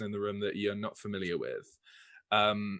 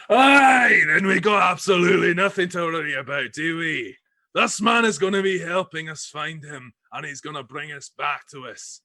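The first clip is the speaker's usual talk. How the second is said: much higher, loud booming cheer; roaring with glee; 6 semitones higher, 6 dB louder than usual. Acted. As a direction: bellow it out, delighted. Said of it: "bursts out in a tremendous roar of laughter".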